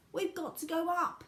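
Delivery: high, loud, insistent call; yelling back. A narrator's reading. Wonka yells back at Grandpa George, high and insistent. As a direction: shout it over the din. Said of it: "yelled Mister Wonka back".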